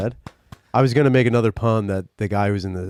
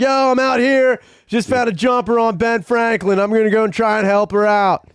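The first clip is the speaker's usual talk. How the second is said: hyped vlogger voice; 9 semitones higher than usual. Pretend. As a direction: narrate it like a hyped-up live stream.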